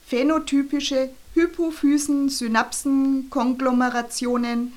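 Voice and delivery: high, loud reading of word monsters; declaimed jargon. A narrator's reading.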